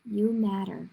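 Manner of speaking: plain reading voice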